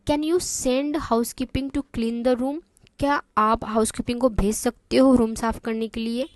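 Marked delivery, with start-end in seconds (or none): 0.1-2.6 slow, even reading; slow and clear. Shown as a demonstration. Teacher reading the receptionist's answer slowly. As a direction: read it slowly for beginners. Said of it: "मैं इतना आराम आराम से इसलिए बोल रही हूँ".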